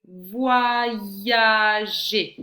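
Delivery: loud, sustained "Voyager", syllables held long; slow, projected dictation of "Voyager". Shown as a demonstration. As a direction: stretch out every syllable of "Voyager". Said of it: "dans quelle syllabe tu entends".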